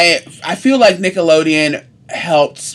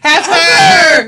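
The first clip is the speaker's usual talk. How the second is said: excited cry; 10 semitones higher, 4 dB louder than usual. Real, not acted. Excited cartoon name callout.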